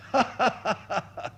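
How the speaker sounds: high, technical stage laugh let loose; giving way to laughter. Shown as a demonstration. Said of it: "Now give way".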